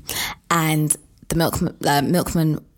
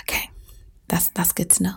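whispered; 6 dB quieter, faster than usual. Real, not acted. Sheepish after correction.

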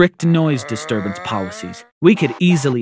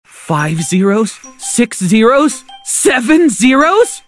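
slow, climbing higher and louder with each count; mounting excitement; 7 semitones higher, 4 dB louder, much slower than usual. A narrator's reading. Boy counting zeros on a scratch card, mounting excitement. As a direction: build to high, stunned disbelief.

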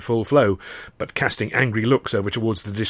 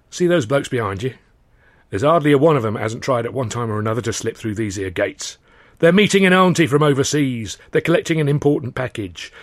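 chatty, knowing London gatekeeper; Cockney dock gatekeeper. Acted. Gatekeeper warning a stranger at the gates, knowing and chatty. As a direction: lay down the rules like a gate man who's seen it all.

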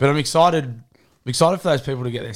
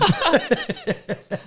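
very high giggling; high, tickled cackle; real laughter. Real, not acted. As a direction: burst out giggling at the joke. Laughing at user-generated content joke.

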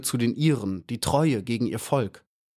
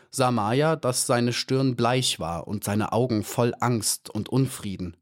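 ordinary delivery, nothing marked

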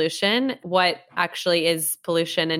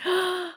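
surprised gasp; 12 semitones higher, 5 dB quieter than usual. Real, not acted. Baby size news.

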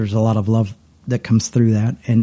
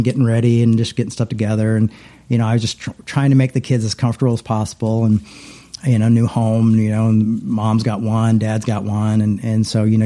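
ordinary talk

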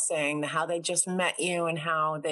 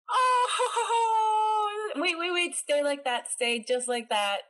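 excited shout; 12 semitones higher, slower than usual. Real, not acted. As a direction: yelp at the surprise puppy. Sees the husky appear on camera.

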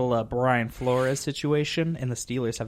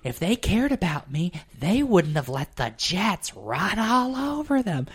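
aggrieved mother; 7 semitones higher than usual. Retold or imitated. Mom betrayed by her losing team, theatrically hurt. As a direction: stretch out the mock heartbreak, pitched way up. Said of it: "she'll be like".

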